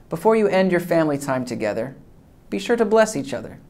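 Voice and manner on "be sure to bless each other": plain reading voice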